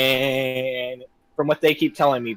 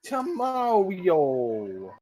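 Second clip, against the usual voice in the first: a drawn-out, very slow mock Italian tomato; 4 semitones higher, much slower than usual. Repeating the pun to his friend, very slow mock Italian. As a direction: stretch it slowly in a light Italian accent. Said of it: "what do you call an Italian tomato".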